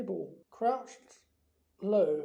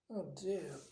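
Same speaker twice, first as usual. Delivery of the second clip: soft regretful sigh of a phrase, softer; sad; 7 dB quieter than usual. Acted. Hermione after Myrtle flees, soft and sad. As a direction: say it quietly, sorry. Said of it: "said Hermione sadly".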